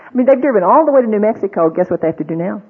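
normal talking voice

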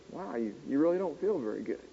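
quick, hushed parroting voice; mock active listening. Shown as a demonstration. Mimicking a textbook listener, quick and hushed. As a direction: echo it back in a soft, quick parrot. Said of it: "you're supposed to say".

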